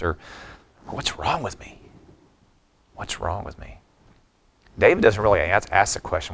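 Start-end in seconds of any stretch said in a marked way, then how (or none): 0.9-1.8 higher and softer, pleading with himself; anguished self-question. Retold or imitated. Pastor voicing his own inner self-reproach. 2.9-3.8 unvoiced whisper, almost to himself; whispered self-reproach. Pastor voicing his own inner self-reproach.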